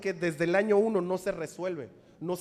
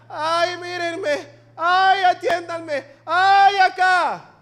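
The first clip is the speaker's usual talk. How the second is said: high, loud, slow mock whine; mocking a self-pitying victim; 7 semitones higher, 8 dB louder, much slower than usual. Preacher mocks a believer who plays the victim, high whiny wail. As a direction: ham up a needy attention-seeker. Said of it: "no te hagas la víctima".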